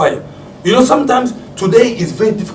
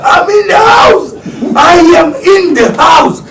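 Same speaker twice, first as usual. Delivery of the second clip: much higher, loud, slow announcing; insistent shout; 13 semitones higher, 7 dB louder, slower than usual. Retold or imitated. Father telling ignoring kids he is home, loud insistence. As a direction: drag each word out demanding notice.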